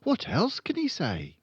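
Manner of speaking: put-on high little-girl voice; high, curious girl character; curious Matilda. A narrator's reading.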